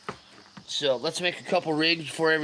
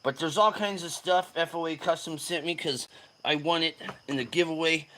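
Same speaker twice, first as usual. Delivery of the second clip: ordinary talk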